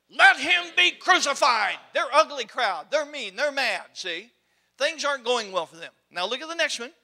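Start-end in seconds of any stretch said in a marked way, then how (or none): 0.1-1.8 loud, slow mob demand, dragged out; vigorous mob cry. Acted. Preacher voicing the crowd, calling loud for crucifixion. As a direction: shout it like an angry mob. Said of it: "now, a little more vigor there".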